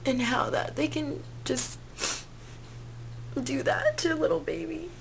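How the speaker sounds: real crying, words squeezed out between sobs. Real, not acted. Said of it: "already getting me really choked up".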